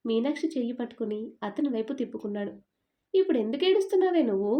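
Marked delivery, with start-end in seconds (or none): 3.1-4.5 higher, louder and fast, fed up; exasperated outburst. Acted. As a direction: blurt it out in exasperation. Arjun snaps at crying Meena. (విసుగుతో గట్టిగా వేగంగా అరవడం)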